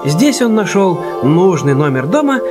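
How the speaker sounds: normal talking voice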